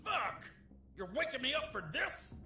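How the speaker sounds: angry shout. Acted. Officer woken up, furious.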